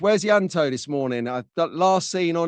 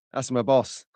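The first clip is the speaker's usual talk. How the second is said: ordinary talk, nothing marked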